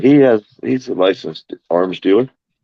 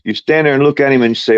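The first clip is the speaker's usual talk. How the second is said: ordinary delivery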